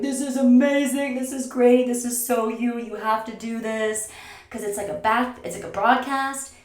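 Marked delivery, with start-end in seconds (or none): 0.0-4.1 high, loud gushing friends; imitating excited friends. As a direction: play the thrilled friends cheering her on. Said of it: "And they're all like".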